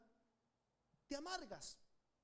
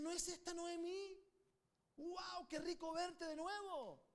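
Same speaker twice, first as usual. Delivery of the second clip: higher, delighted exclaim of welcome; joyful greeting; 6 semitones higher, slower than usual. Acted. Townsfolk greeting Noemí, higher and delighted. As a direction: greet an old friend with joy. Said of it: "Se alegraron".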